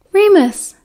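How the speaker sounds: put-on a girl hailing Remus as he enters; Lily calling. A narrator's reading. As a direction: call his name eagerly, up high.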